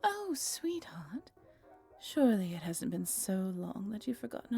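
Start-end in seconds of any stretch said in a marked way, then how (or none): 0.0-1.3 "Oh, sweetheart" whispered very slow and higher, rising on "Oh"; a slow, high, tender whispered "Oh, sweetheart"; tender pet name. Acted. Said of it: "our little pet names".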